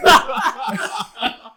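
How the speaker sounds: slow, laughing through the last words; laughing punchline. Real, not acted. Finishing the grandpa story to friends, laughing it out. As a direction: crack up as you finish the story.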